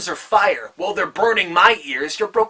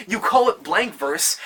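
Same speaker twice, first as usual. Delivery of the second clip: usual speaking voice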